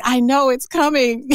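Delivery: high-pitched imitation of a friend; mock reluctant. Taurus friend dreading the coming change, mock reluctant.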